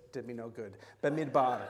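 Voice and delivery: ordinary talk, nothing marked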